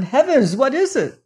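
high, swooping exclamation; alarmed cry. A narrator's reading. Son demanding an answer from his father, alarmed cry. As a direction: exclaim in alarm, pitch leaping. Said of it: "cried his son".

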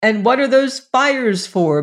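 mock bellow over a crying sister. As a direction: bellow the question over the noise. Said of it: "bellowed George".

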